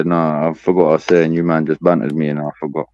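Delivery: ordinary talk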